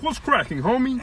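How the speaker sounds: high-pitched put-on homie voice; hammed-up high caricature greeting that comes out like Kermit; Food's Gone Wild. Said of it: "I was trying to do Food's Gone Wild".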